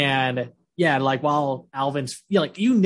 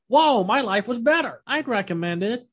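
much higher, wowed ghost reaction; mock amazement; 8 semitones higher than usual. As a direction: exaggerate the sudden happy realization. Acting out ghost's sudden realization.

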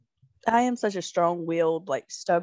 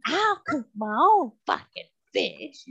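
raised-pitch indignant retort, retold; angry dismissal; 4 semitones higher than usual. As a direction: re-enact a fed-up wife telling him off. Acted quarrel with husband.

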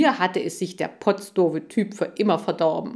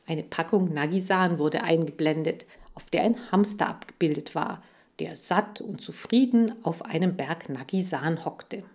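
ordinary delivery

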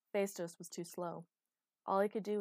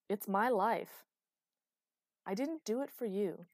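loud, impassioned back-and-forth; angry, emphatic outburst; heated quarrel; 5 semitones higher, 5 dB louder than usual. Acted. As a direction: argue with raised voice, stressing key words. Acted quarrel, rapid angry exchange.

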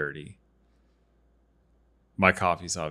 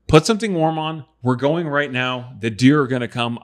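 urgent hurry; 4 semitones higher, 7 dB louder than usual. Retold or imitated. Hurrying daughter to the blind.

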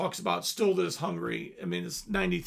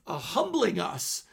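usual speaking voice

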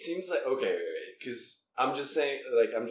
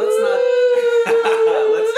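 laughing; 16 dB louder than usual. Real, not acted. Laughing off a teasing remark.

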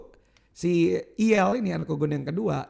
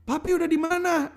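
put-on much higher kid's question; imitating son; 12 semitones higher than usual. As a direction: mimic the boy calling his dad on the phone. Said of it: "tiba-tiba dia telepon". (anak bertanya di telepon, suara tinggi)

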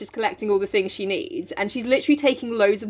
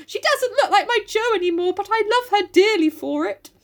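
mock doting; 12 semitones higher than usual. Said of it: "general outbursts".

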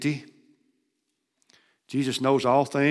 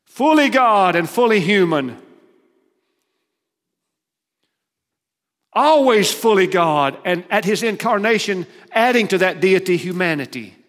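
preaching at full force; 6 semitones higher, 9 dB louder than usual. Acted. Preacher proclaiming Christ fully God and fully human, booming. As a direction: thunder the creed to the pews.

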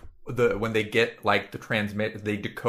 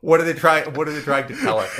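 mock boardroom panic; 3 semitones higher, 4 dB louder than usual. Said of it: "the people are like".

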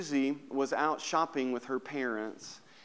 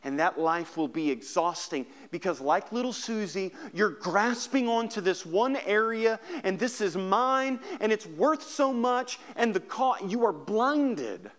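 fervent preacher lifting the room; preaching at full force; 8 semitones higher, 4 dB louder than usual. Acted. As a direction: build the sermon to a passionate peak. Preaching climax, raised voice.

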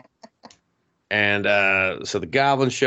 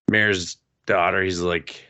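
usual speaking voice

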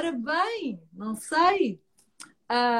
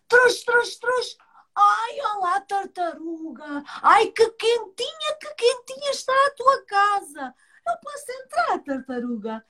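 fox voice; 8 semitones higher than usual. Acted.

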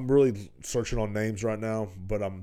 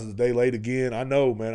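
ordinary talk, nothing marked

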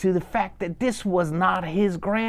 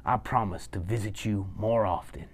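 low, softer, sheepish promise; a bit embarrassed; 5 semitones lower, 5 dB quieter than usual. Acted. Grandson promising grandmother more visits, mildly embarrassed. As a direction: give in softly with mild embarrassment. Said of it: "he was a bit embarrassed".

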